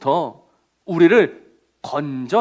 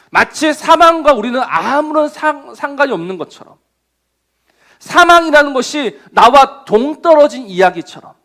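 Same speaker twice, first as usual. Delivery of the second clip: ordinary talk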